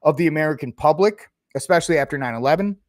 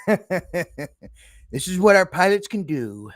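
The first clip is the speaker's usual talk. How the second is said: mock Trump; 3 semitones higher, much slower than usual. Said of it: "What movie do you think Trump".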